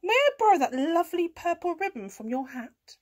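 high rising request; cat asking. A narrator's reading. Cat asking a farm animal for things, high cat voice. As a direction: ask politely in a lifted cat voice. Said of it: "said Kat".

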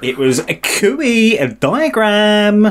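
high, slow silly voice. Shown as a demonstration. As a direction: squeak it out slowly, up high. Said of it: "In that, in that voice".

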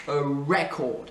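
"record" said REC-ord; stress on first syllable. Shown as a demonstration. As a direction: hit REC in "record", slowly. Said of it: "c'est la première, euh, syllabe". (« record » accentué sur la première syllabe)

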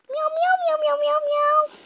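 very high little cat meowing; kitten meows. Acted. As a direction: meow like a small kitten. Playing the cat.

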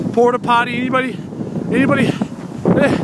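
calling out. Acted. Calling out jokingly.

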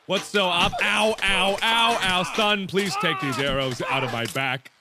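normal talking voice

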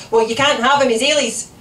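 loud, high scolding; cross mother. A narrator's reading. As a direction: shut the demand down firmly and loudly.

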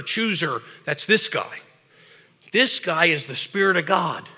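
ordinary talk, nothing marked

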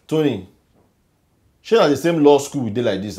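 indignant outburst. Acted. Henry confronts Tony about law school, loud. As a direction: call him out hard.

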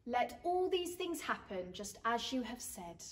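soft, humble submission; bow your head and say it quietly. Acted. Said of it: "bowing her head".

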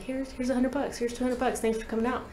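light, hushed imitation of a grateful promoter; casual payout. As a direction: imitate promoters slipping you cash, softly. Promoters paying him, acted softly.

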